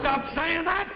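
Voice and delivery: high-pitched command; agitated protest. Acted. Husband silences wife's crazy talk, agitated. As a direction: pitch it up, touchy and upset. Told men will take him away.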